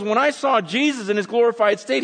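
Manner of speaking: high, excited retelling; acted wide-eyed astonishment; awestruck witness. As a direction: gush in amazement as John describing the vision. Acts John awestruck by the vision.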